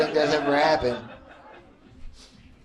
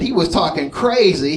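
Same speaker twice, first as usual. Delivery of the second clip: usual speaking voice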